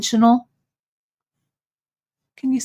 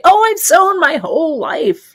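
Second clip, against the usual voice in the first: mock proud boast; 8 semitones higher, 4 dB louder than usual. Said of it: "like I say".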